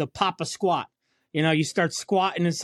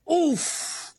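impact grunt; 10 semitones higher than usual. Retold or imitated. Bracing for a curb hit.

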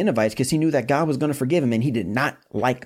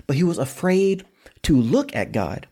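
ordinary delivery

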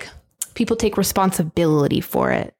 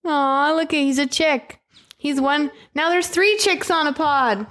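raised, fond cooing voice; 6 semitones higher than usual. Real, not acted. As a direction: gush over the tiny chick. Cooing at duckling.

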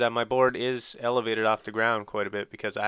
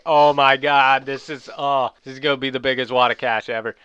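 excited disbelief; 2 semitones higher, 7 dB louder than usual. Retold or imitated. Retold excitement at the ATM.

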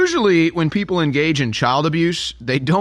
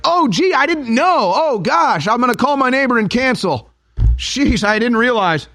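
high mock-defeated voice, dripping sarcasm; sarcastic surrender; 9 semitones higher than usual. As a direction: play the beaten man with heavy irony. Sarcastic fake remorse.

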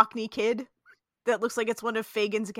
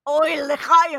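higher, louder hammed-up Yiddish toast; Yiddish accent; 6 semitones higher, 9 dB louder than usual.

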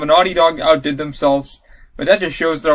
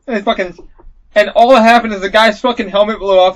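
ordinary talk, nothing marked

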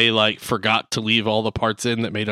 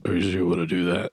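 much lower, softer, fast menacing warning; deep Blade voice; 7 semitones lower, 4 dB quieter, faster than usual. Retold or imitated. Unseen Blade warns Jon Snow, low fast menacing voice. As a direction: murmur it like an unseen threat. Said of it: "he's like".

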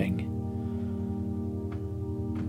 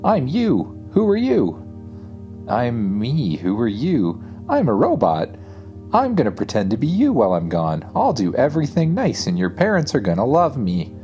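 robot clone; 5 semitones higher, 4 dB louder than usual. Acted. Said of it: "says your robot clone".

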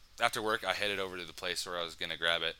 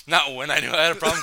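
loud and fast, rising, getting worked up; excited; 7 semitones higher, 10 dB louder, faster than usual. Real, not acted. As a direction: blurt it out louder and louder. Excited confession.

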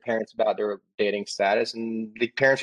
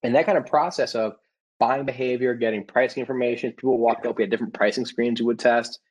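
ordinary talk

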